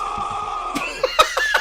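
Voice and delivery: high shriek of laughter, real. Real, not acted. Viewer shocked by the sudden no, shrieking laugh. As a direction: burst out in a squealing laugh.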